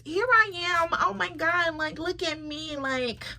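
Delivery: high, needy whining mock voice; mock a needy pick-me girl begging for attention; high mock whine of a needy woman. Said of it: "One of those".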